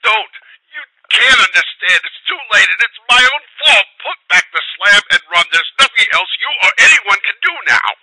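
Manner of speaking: very high, loud despairing screams; screaming in utter hopelessness. A narrator's reading. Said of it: "changed to a scream of utter despair".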